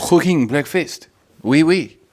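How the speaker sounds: high, loud, hammed-up French accent; mock French accent. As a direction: ham up a slow French accent. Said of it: "put on a French accent".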